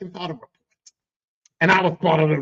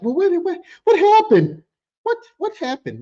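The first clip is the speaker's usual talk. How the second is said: high, loud, incredulous questioning; baffled retold outburst; 10 semitones higher, 5 dB louder than usual. Boss reacting to the kid quitting, high-pitched bafflement. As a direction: act out being caught off guard, pitch jumping way up.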